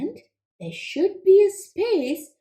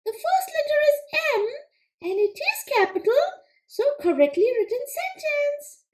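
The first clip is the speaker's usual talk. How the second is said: high, quick little frog puppet, eager to answer; squeaky-high puppet frog pleased with itself; Froggy puppet voice; 12 semitones higher than usual. Acted. Frog puppet answering the teacher, high and eager.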